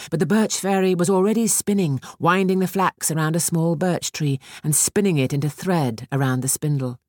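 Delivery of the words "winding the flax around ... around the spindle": usual speaking voice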